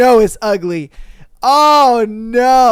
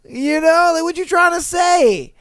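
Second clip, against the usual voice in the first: higher and louder, mock offended; mock outraged; 9 semitones higher, 3 dB louder than usual. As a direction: push the question up, fake outrage.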